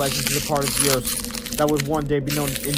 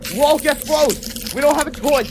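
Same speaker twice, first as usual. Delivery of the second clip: put-on urgent kid voice; 11 semitones higher, 4 dB louder than usual. A narrator's reading. George insisting they must save Mister Crab, high and urgent. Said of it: "said George".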